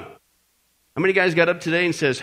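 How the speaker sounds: ordinary delivery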